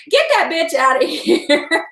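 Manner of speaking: ordinary talk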